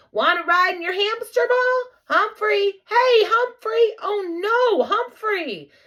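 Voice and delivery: high, loud calling voice; boy calling. Acted. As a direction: call for the missing pet. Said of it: "Simon's muffled voice say".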